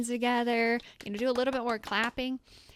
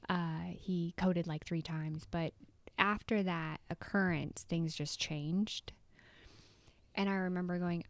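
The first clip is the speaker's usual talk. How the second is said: normal talking voice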